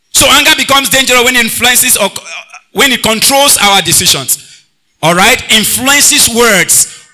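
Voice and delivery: loud, high, drawn-out preaching; preaching at full force. Acted. Pastor preaching to the choir about anger, at full force. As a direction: preach it over the music to the church.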